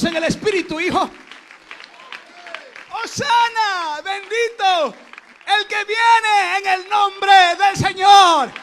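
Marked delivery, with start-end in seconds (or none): 2.9-8.5 high, loud cry with long-held words; stretched-out shout of praise; shouted acclamation. Acted. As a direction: proclaim it slowly at full voice.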